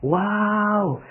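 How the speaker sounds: high, impressed exclamation; admiring wow. Shown as a demonstration. A viewer praising a jailed official, high and impressed. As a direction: sound truly impressed. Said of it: "coba Anda ngomongnya gini".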